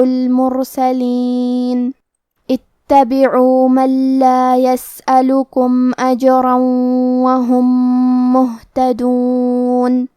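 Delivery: slow, drawn-out melodic recitation; Quran recitation. Acted. Teacher recites the passage to students, melodic tajweed.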